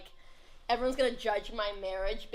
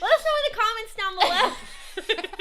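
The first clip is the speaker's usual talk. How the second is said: laughing; 11 semitones higher, 8 dB louder than usual. Real, not acted. Sign-off laughing.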